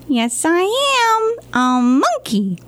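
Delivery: high, slow monkey voice; silly monkey voice. Acted. Librarian reading to kids, slow high monkey voice. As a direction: drag it out as the monkey.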